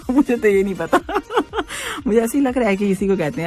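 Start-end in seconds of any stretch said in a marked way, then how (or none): 1.0-2.0 soft high giggle; quiet falling giggle; real laugh. Real, not acted. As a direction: laugh at your own guess. Laughs at not knowing meaning.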